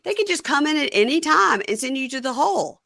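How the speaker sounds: high-pitched, mock-wounded protest; aggrieved complaint. Host mimics prisoner complaining to girlfriend, aggrieved high whine. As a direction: imitate someone whining that they are misunderstood.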